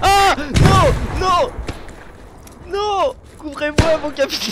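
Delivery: cris paniqués, aigus et forts; cri couvrez-moi. Acted. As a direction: hurle de panique pour qu'on le couvre. Pris sous le feu, appelle. (cri paniqué)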